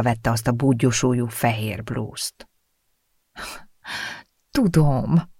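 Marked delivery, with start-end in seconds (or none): 4.5-5.3 sighing. A narrator's reading. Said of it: "sóhajtott a lány".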